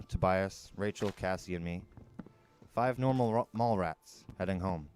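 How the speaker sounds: plain reading voice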